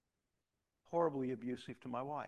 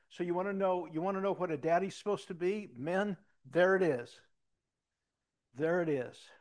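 preaching at full force; 5 semitones higher, 10 dB louder than usual. Acted. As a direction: drive it home to the men in the pews. Preaching climax to men.

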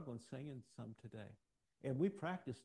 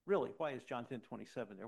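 ordinary talk

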